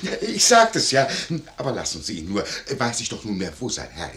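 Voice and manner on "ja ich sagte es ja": high, loud "told you so"; triumphant outburst. Acted. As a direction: crow it out, vindicated. (triumphierend laut ausgerufen)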